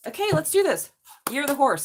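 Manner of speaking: loud, high, fired-up start. Real, not acted. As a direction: kick off the reading with a burst of energy. Launching the horse reading.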